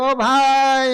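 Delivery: a loud call for help, retold. Dangling miser calls loudly to the camel rider. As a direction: hollers to the passing man. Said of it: "એટલે આને બૂમ પાડે કે".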